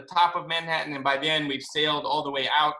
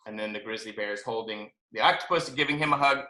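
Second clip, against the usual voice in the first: ordinary delivery, nothing marked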